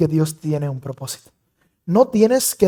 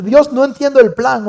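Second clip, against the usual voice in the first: imitated complaint; 8 semitones higher, 5 dB louder than usual. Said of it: "Y tú dices".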